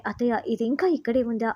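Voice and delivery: ordinary delivery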